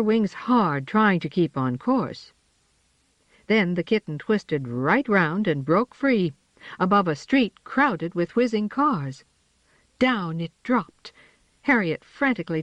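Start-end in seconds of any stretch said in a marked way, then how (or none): none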